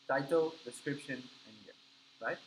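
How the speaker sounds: ordinary talk, nothing marked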